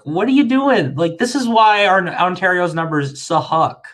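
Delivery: high, loud exasperated outburst; exasperated rant. Retold or imitated. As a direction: throw up your hands in disbelief. Exasperated quote of himself.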